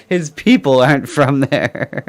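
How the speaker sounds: laughing while repeating the line; amused laughing echo; laughing quote. Retold or imitated. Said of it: "I just love that comment".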